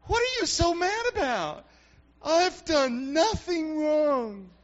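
put-on high, whining kid with a cry in it; be the sulky kid who thinks he's innocent; a child whining at an angry parent; whiny child. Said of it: "That was kind of the attitude".

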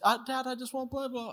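high mock child whine; whining toddler. Two-year-old son makes excuses to his dad, whining. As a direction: imitate a little kid's high protest.

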